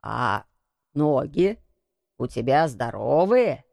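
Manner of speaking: put-on low, hesitant old man; lightly voiced shaken old man; trembling voice. A narrator's reading. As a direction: ask haltingly, with a slight tremble. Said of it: "ещё более дрожащим голосом".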